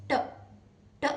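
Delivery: ट tapped short, no vowel; a crisp ट; the phonic T, ट; short t sound. Shown as a demonstration. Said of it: "टी की sound जो होती है वो होती है".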